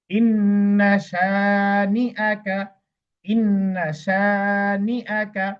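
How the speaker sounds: drawn-out chanted recitation; slow Quran recitation. Shown as a demonstration. Teacher recites the verse slowly for students to follow. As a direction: chant each syllable slowly.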